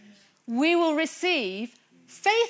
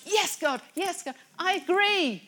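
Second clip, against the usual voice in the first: high, loud shouts of praise; excited agreement; 7 semitones higher, 6 dB louder than usual. Acted. Preacher acting out joining a friend's praise, excited shouting. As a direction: echo a fellow worshipper with full enthusiasm. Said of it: "I'm like".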